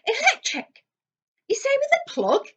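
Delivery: high, loud thrilled wonder; delighted by a new gadget; dad excited about an electric fire. A narrator's reading. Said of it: "said Mister Weasley's voice excitedly".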